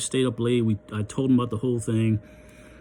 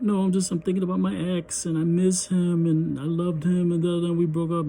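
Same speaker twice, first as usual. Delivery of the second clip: imitating her crying; 6 semitones higher than usual. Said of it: "and she starts crying".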